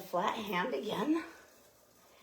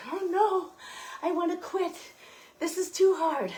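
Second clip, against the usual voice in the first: higher, mock-whiny complaint; whiny quitter voice; 9 semitones higher than usual. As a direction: play the tired inner voice begging to quit. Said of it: "it's gonna feel like".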